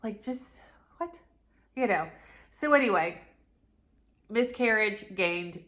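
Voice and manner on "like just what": breathless, barely voiced what; stunned whisper. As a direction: hush it out in disbelief. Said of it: "I'm just like".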